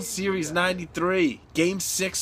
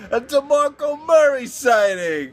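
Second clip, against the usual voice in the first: mocking heckler; 7 semitones higher, 5 dB louder, slower than usual. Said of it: "some fan goes".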